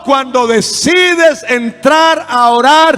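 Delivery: loud, drawn-out emphatic sermon; full-force preaching. Real, not acted. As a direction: preach it slowly, punching each phrase.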